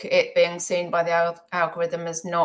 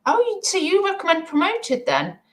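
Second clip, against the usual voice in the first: high questioning voice; 12 semitones higher, 4 dB louder than usual. Retold or imitated. Voicing a viewer question.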